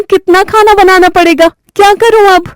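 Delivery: put-on high, exasperated young bride; dismayed bride. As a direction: groan at all the cooking ahead. Reema dismayed. (ऊँची, झुँझलाई बहू की आवाज़)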